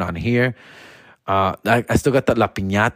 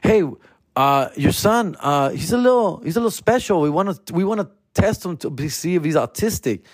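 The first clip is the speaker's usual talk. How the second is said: teacher voice; 7 semitones higher than usual. Retold or imitated. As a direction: play the careful teacher breaking news. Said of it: "He just said".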